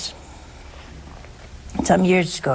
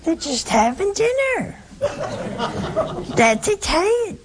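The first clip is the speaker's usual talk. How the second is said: amused explanation; 10 semitones higher than usual. Retold or imitated. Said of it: "I said".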